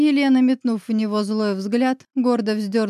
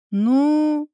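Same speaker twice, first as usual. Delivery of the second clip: drawn-out, higher musing; thoughtful drawl; 6 semitones higher, 4 dB louder than usual. A narrator's reading. Grisha weighing up whom to call, thoughtfully drawn out. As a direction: stretch it out as if weighing options. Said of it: "задумчиво протянул Гриша".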